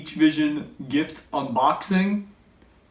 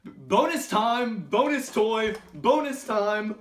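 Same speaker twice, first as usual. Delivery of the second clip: excited announcer; 6 semitones higher than usual. Acted. Announcing bonus segment.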